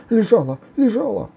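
high, loud panicky ogre cry; крик людоеда. A narrator's reading. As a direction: yell it high in frantic self-defence. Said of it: "кричал людоед". (испуганно кричит высоким голосом)

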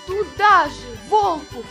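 ordinary delivery

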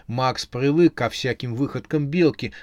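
plain reading voice

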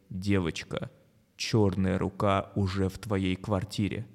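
low, slow menacing voice; creepy broadcast voice, drawn out; scary voice. Acted. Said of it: "Чей-то голос говорит".

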